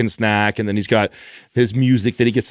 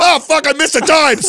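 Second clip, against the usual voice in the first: much higher, louder hammed-up curse; mock frustrated outburst; 5 dB louder than usual. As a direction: play the guy who just missed the showtime. Acting frustration missing movie time.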